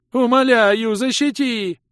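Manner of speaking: high, loud, slow creature sobbing plea; tearful high creature begging; wailing plea. A narrator's reading. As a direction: wail it, clinging to his trousers. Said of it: "продолжал вопить лохр".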